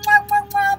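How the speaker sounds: blown kisses. Real, not acted. Blowing kisses goodbye to kids.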